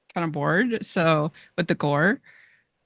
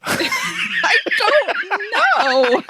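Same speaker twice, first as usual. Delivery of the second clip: high laughing disbelief; 14 semitones higher, 4 dB louder, much slower than usual. Real, not acted. To the host about fans, laughing. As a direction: laugh it out, baffled. Laughing at absurd fan behaviour.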